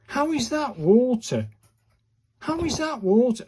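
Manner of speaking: high, loud incredulous question; can't believe it, pitch way up; how on earth, exasperated. Real, not acted. Disbelief at Japanese word.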